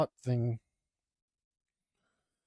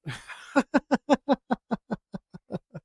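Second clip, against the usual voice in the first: relieved laugh; 9 semitones higher, 4 dB louder than usual. Real, not acted. Relief after finishing boring task.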